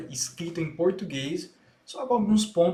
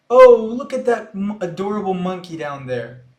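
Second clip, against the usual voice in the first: mirroring the clip; 9 dB louder than usual. Shown as a demonstration. Teacher shadowing the clip, loud excited exclamation. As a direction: burst out with the clip's delight. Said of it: "tenta repetir da mesma forma".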